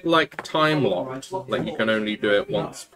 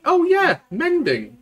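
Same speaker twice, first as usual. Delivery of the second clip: surprised realisation; 4 dB louder than usual. Real, not acted. Sudden realisation, high pitch.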